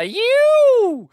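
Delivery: high mocking hoot; a shrill jeering "You!"; sarcastic hoot. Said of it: "a sarcastic hoot was all it took".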